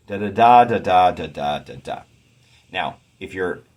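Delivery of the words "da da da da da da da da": "Da, da, da" chanted da-da-DA, then alternating weak-strong; loud first stress on the third "da", falling away after; scansion beat. Shown as a demonstration. Teacher beating out the second line, scansion beat. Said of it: "Let's look at this next line".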